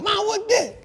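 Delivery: put-on high, curious kid voice; imitating daughter. Dad voicing his daughter asking about the pot, high and curious. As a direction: pitch up to play the little girl asking.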